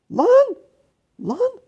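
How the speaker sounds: high, sharp shrieked lan; shrieking at the enemy. Real, not acted. Gamer yells at enemy. (tiz bir çığlık)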